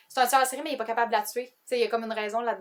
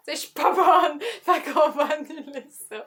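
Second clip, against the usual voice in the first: real giggle through the words, higher and louder; laughing; 5 semitones higher, 7 dB louder than usual. Real, not acted. Admitting she is no good at jokes, laughing. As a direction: give up and laugh while speaking.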